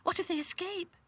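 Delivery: high, anxious doll voice; nervous. A narrator's reading. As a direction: worry aloud in a tiny high voice. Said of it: "asked Lucy nervously".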